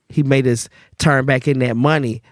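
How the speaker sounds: ordinary talk, nothing marked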